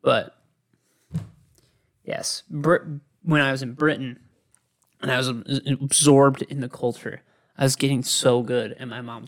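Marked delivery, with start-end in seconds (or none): none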